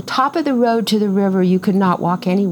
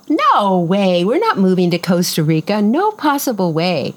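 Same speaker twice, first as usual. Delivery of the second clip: emphatic refusal, retold. Wife refusing husband's plan to buy the farm, emphatic retold refusal. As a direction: replay a flat-out refusal with exaggerated emphasis.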